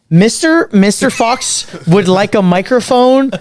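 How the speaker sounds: high, slow timid ask; nervous question. Retold or imitated. Young host nervously asking staff for a microphone, high. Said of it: "I'm like".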